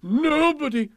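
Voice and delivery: high, loud whoop; exultant royal cry; gleeful king. A narrator's reading. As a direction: sing it out while whizzing along. Happy King sliding down the banisters.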